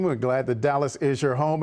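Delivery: ordinary talk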